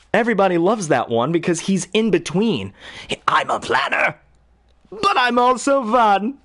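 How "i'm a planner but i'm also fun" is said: rising, slowing playful Joker. Retold or imitated. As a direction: start sly, end high and gleeful. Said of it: "do you want Mark Hamill".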